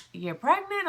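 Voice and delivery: stunned, high questioning echo; dazed. Retold or imitated. Husband echoing the news in a daze, stunned. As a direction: ask it in a daze, disbelieving. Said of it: "in a daze".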